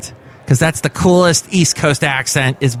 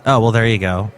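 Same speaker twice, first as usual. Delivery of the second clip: ordinary delivery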